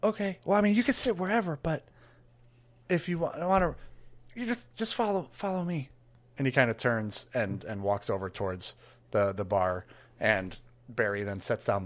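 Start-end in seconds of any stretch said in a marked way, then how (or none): 0.0-5.9 higher, stammering, nervous helper; flustered Trevor. Acted. Trevor flustered by the huge cleric, higher and stammering. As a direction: babble nervously up in pitch.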